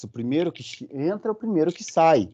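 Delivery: ordinary talk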